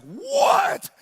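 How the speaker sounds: high, loud exclaimed what; amazed shout. Retold or imitated. Dad marvels at opening the cupcake box, amazed shout. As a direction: burst out in mock astonishment.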